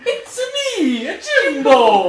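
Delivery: high laughing plumber voice; Mario voice. Pretend. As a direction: squeal it like Mario. Playing along as Mario.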